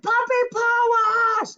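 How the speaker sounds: high, loud all-caps shout; yelling. Shown as a demonstration. Teacher showing all-caps as yelling, big shout. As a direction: shout the name like all capital letters. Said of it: "so that you're yelling at".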